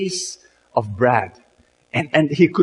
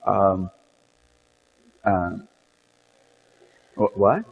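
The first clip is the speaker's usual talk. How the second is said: flustered, broken-off stammer; stammering in shock; 5 semitones lower, 6 dB quieter than usual. Acted. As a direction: stumble over the words, lost for speech. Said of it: "lost his voice for a while, and he spoke gibberish".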